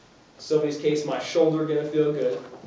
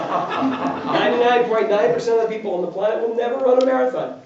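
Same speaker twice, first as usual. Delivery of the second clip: talking through leftover laughter, louder and higher; laughing; 6 semitones higher, 5 dB louder than usual. Real, not acted. Mocking marathon goals while still laughing. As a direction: still chuckling as you make the point.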